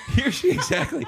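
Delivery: high, breaking into laughter; can't get the words out for laughing. Real, not acted. Cracking up at friend's joke.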